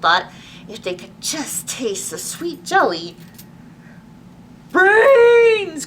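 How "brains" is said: loud, high, drawn-out zombie call; zombie cry. Acted. Reginald calls the zombies to the sandwich with a loud, high cry. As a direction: bellow it to lure the zombies. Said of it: "cried Reginald".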